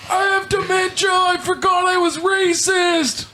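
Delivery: put-on high screeching old man; old man shouting. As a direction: yell it like a confused old man. Playing an old person.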